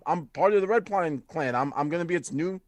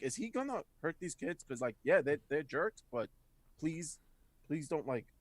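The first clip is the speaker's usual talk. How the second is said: hushed, hesitant pleading; worried plea; 10 dB quieter than usual. Acted. Jalden worries the climber will hurt the kids, soft plea. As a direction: plead softly, trailing off between phrases. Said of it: "is like".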